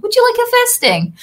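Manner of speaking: a high, fast involuntary blurt, retold; sudden tic blurt. Retelling her tic to the nurse, a sudden high blurt. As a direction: burst it out high and quick like a tic.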